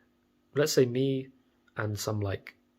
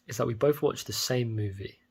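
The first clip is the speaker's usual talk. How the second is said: ordinary talk, nothing marked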